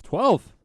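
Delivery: twelve punched high and loud; stress on twelve. Real, not acted. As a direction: hit the word twelve, incredulous. Stressing tiny subscriber count.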